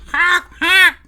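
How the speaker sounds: a loud, high, hammed-up cackle of the Crow; a deliberately bad Crow laugh. Vlogger does a bad Crow laugh for viewers, loud and high. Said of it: "That's the worst Crow impression you've ever heard".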